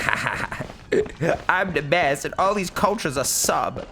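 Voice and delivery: arrogant mock voice. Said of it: "I'm not like".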